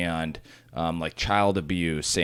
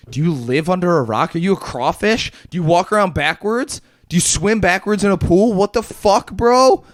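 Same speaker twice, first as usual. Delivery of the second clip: high, loud disbelieving rant at a clueless friend; incredulous yelling; 10 semitones higher, 6 dB louder than usual. Real, not acted. To a friend who missed the news, loud incredulous yelling. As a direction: hammer him with mock-outraged questions. Mock outrage at friend not knowing.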